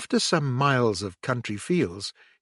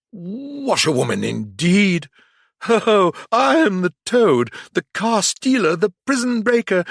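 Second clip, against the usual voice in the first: boastful triumph; 7 semitones higher, 5 dB louder than usual. A narrator's reading. Toad boasts wildly.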